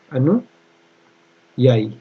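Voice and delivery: very slow, drawn-out अनुयायी with a break inside; spelling-out pace, pausing mid-word; syllable-by-syllable dictation. Shown as a demonstration. Teacher dictating spelling slowly.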